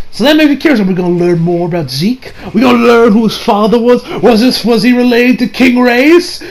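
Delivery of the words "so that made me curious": ordinary delivery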